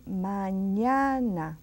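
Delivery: Mañana said very slowly, ma-NYA-na; teacher's slow Mañana; slow model word. Shown as a demonstration. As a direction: draw Mañana out syllable by syllable. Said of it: "Repeat after me".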